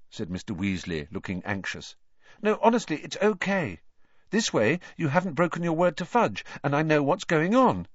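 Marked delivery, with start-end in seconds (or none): 2.4-7.9 put-on high, earnest boy voice; insistent young lad putting someone at ease; earnest reassurance. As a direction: reassure eagerly in a higher voice. Boy reassures an anxious man.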